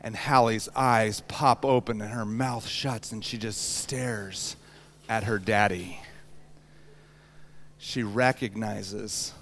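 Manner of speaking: slow, soft and low; hushed tender storytelling. Real, not acted. Preacher telling how newborn knew her father, hushed. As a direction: let it drop to a gentle hush.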